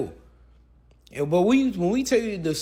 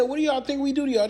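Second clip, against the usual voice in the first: mocking quote; 9 semitones higher, faster than usual. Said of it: "He said".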